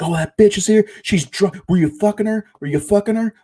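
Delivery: very high, loud imitated screeching accusation; furious jealous screech. Girlfriend screaming accusations at her boyfriend, shrill imitated fury. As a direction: mimic her shrieking at him, hysterical. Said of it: "she lost her fucking mind".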